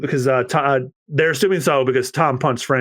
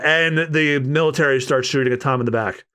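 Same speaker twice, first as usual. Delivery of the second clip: normal talking voice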